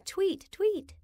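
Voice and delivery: high, chirpy birdsong imitation; bird tweet. Bird in a tree tweets at the listener, high and chirpy. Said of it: "When in a tree I say".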